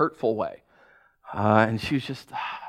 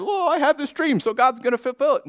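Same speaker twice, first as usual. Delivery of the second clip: mocking; 11 semitones higher than usual. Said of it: "I'm so tired of".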